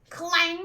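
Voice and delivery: loud ringing clang like a giant bell. Acted. Voicing the golden bell for the listeners, a ringing clang. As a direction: belt out the bell's clang.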